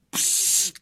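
high, sharp escaping psst; hushing hiss. A narrator's reading. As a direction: let out a thin hiss. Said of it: "udělal Maličký".

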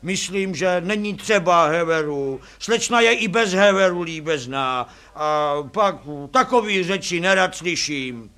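loud objecting driver; taxi driver character. A narrator's reading. Taxi driver refuses the jack for the photo, loud character voice.

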